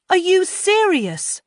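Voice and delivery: high, slow, loud shriek of disbelief. A narrator's reading. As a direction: shriek it in outrage. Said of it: "shrieks Roz".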